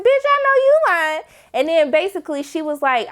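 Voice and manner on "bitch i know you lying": high, loud call-out; loud disbelief. Real, not acted. Viewer yelling at Michael's lie, high loud. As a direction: shout him down in disbelief. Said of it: "I said".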